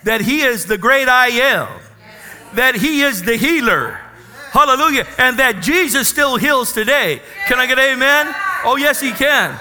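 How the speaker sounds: full-voiced gospel preaching with laughs of joy. Real, not acted. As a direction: stir the congregation up to answer amen. Preaching with call and response.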